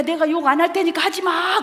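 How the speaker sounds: put-on high, loud childish bargaining; mimicking student. Imitating a student bargaining with the professor, high loud childish bargaining. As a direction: play the kid making a deal. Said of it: "그래, 나더러".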